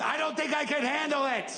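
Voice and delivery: comically high, overwhelmed whine; high whiny reply. Retold or imitated. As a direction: squeak it out like you can't cope. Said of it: "I responded with".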